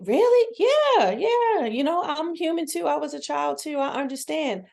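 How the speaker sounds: high-pitched, incredulous then easing; acted amazement. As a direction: squeal the surprise, then ease down into reassurance. Acted candid chat with child.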